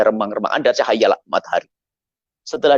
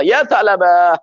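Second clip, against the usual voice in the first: calling out; 6 semitones higher, 5 dB louder, much slower than usual. Acted. Prophet calls to the frightened boy.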